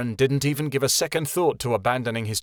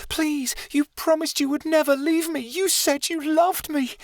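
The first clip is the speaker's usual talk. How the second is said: put-on abandoned girl begging Keanu, pleading; desperate plea. A narrator's reading. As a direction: beg him not to go. Chameleon pleading.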